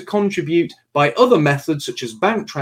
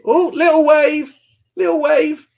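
high, cooing parent voice; doting squeal-like daddy coo; 10 semitones higher than usual. Real, not acted. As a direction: praise a little one's effort, pitched way up. Delighted at child's small wave.

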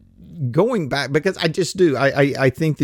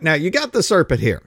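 ordinary delivery

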